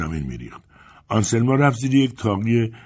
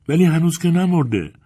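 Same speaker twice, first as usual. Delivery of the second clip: put-on high, louder little-girl voice; child protesting; 10 semitones higher, 5 dB louder than usual. A narrator's reading. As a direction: object with a child's insistence.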